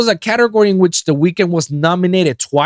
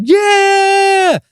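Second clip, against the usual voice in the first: crowd cheer; 4 dB louder than usual. Retold or imitated. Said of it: "everybody's like".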